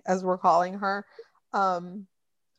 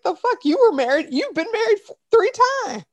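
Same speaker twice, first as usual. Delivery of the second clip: high, loud disbelief; mock-indignant yelp at her thrice-married mother; incredulous outburst; 12 semitones higher, 5 dB louder than usual. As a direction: squeal it in outrage at mom. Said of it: "And I'm like".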